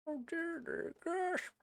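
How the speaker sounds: very high startled yelp of dismay; very high, soft cry of real alarm at a mistake; dismayed squeal. Real, not acted. Realizes he skipped pages.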